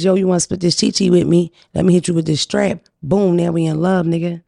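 ordinary delivery

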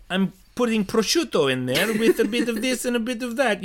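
high, loud hammed-up Italian chef voice; mock Italian accent. Friend retelling a pasta story, suddenly hammed-up Italian. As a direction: slip into an over-the-top Italian accent mid-story. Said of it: "I'll do a voice".

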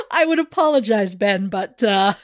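normal talking voice

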